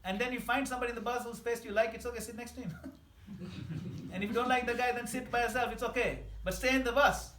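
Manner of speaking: loud, high preaching push; rally the room with big rising energy; preaching at full force. Acted.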